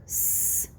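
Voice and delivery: S as a long hiss; letter's sound. Shown as a demonstration. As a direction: sound out "S" as sss, not its name. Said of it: "I hear S".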